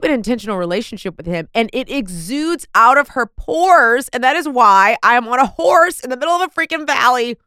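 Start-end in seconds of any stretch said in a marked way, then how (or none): 4.1-7.4 emphatic exclaiming. Real, not acted. Excited intro, emphatic climax.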